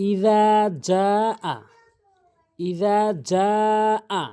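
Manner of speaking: জা drawn out, then a short আ; model recitation. Shown as a demonstration. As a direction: hold জা evenly, then break onto আ. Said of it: "এভাবে পড়বো।".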